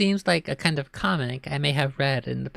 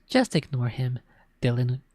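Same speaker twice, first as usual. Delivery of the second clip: ordinary delivery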